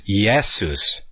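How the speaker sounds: "Jesus" said as "ee-YEH-soos"; Greek-style pronunciation. Shown as a demonstration. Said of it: "of the Savior pronounced as".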